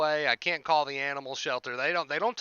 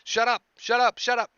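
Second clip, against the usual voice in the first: high, louder pretend outburst; mock frustrated yell; 9 semitones higher, 6 dB louder than usual. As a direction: snap it repeatedly, acting out losing patience. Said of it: "I start getting frustrated".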